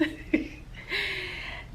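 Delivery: soft, breathy little chuckle; quiet self-amused laugh; brief laugh. Real, not acted. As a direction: laugh it off quietly after a remark. Laughs at own rushed talk.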